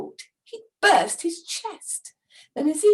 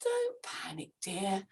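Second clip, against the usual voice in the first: soft whisper; 6 semitones lower, 10 dB quieter than usual. A narrator's reading. Wife calm voice.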